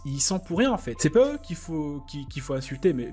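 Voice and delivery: normal talking voice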